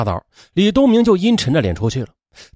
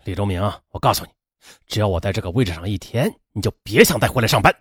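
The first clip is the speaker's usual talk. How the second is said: impatient; 6 semitones lower, faster than usual. A narrator's reading. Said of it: "更加不耐烦了".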